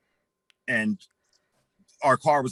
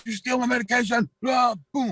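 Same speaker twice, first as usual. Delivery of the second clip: high, piercing accusing shout; outraged shout; 11 semitones higher than usual. Retold or imitated. Cousin catching him stealing pills, high outraged shout. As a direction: catch the thief red-handed and scream it.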